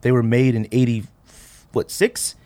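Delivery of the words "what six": usual speaking voice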